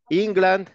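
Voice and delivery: teacher models "England" for students; English pronunciation. Shown as a demonstration. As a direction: announce "England" clearly with English sounds. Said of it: "England, pues es Inglaterra".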